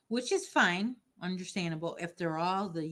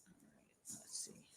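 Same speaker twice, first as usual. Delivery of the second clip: whisper; 20 dB quieter than usual. Real, not acted. Concentrating on changing colors.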